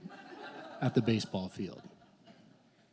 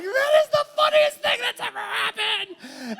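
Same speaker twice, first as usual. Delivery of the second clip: much higher, laughing through the line; cackling with glee at his own prank; laughing hard; 6 dB louder than usual. Retold or imitated. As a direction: crack up as you say it. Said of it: "it's like".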